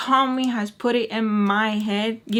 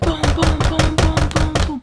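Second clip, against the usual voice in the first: high loud booms mimicking fists on a door; imitated knocking; 8 semitones higher, 6 dB louder than usual. Teller mimics Tommy knocking in the back, loud booms. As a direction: pound it out like someone banging on the door. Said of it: "just knocking".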